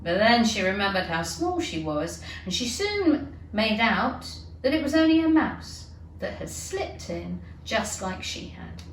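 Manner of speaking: usual speaking voice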